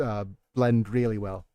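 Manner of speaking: ordinary delivery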